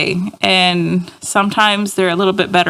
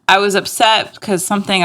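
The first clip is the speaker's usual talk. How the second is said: usual speaking voice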